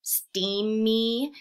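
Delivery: steamy with the final E drawn out slowly; stretched long E. Shown as a demonstration. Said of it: "Y at the end of a word that makes an E sound".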